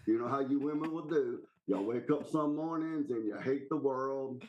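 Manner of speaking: ordinary delivery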